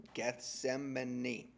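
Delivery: Gethsemane drawn out slowly, syllable by syllable. Shown as a demonstration. Preacher slowing down to say Gethsemane clearly, slowly. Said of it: "Had to say it slow".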